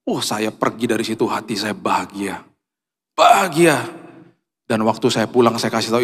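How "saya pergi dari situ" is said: normal talking voice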